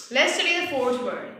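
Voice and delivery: normal talking voice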